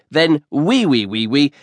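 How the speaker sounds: plain reading voice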